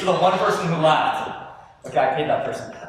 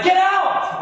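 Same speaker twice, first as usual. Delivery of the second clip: higher, louder snap of get out; mock angry yell; 12 semitones higher, 4 dB louder than usual. Teen yelling at mom offering fruit at Thanksgiving, mock angry. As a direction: fling it at mom like a moody teen. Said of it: "You're like".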